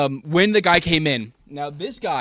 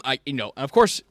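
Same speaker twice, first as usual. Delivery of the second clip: ordinary talk, nothing marked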